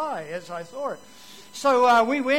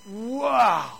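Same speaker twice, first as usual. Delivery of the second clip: awed wow; 7 dB louder than usual. Retold or imitated. Boy marvels at Bible stories.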